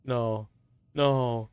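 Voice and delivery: ordinary talk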